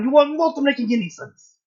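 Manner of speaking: put-on loud, high accent sliding toward German; failed angry Russian accent. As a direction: shout it in a bad Russian accent that sounds German. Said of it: "That's more German".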